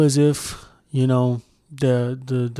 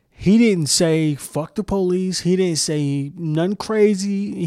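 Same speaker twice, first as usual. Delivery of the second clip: "He didn't say" drawn out slowly, higher; slow emphasis; 4 semitones higher, slower than usual. Real, not acted. Defending the kneeling player, "nothing crazy" stretched for emphasis. Spells out what he never did.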